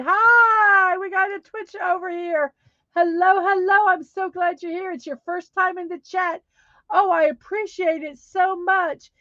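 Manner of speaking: high, loud greeting to a newcomer; light up for the new viewer; bright streamer hello; excited welcome. Real, not acted.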